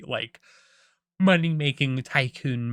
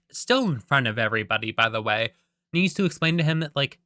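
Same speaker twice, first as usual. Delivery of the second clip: ordinary delivery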